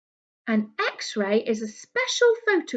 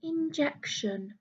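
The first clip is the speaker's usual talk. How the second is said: soft whispered Injection; whispered word; 3 semitones higher, 8 dB quieter, slower than usual. Shown as a demonstration. Whispering Injection to the children. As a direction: breathe Injection as a secret. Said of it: "do you know what an injection is".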